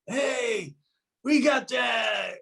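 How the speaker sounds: high, drawn-out holler to a crowd; acted stage call-out. Band calls out from stage.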